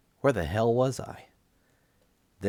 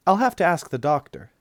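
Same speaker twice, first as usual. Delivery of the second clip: voiced nurse; 9 semitones higher, 7 dB louder than usual. A narrator's reading.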